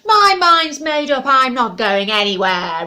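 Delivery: very loud dragon roar. Acted. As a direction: bellow it at the owl. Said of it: "roared the dragon".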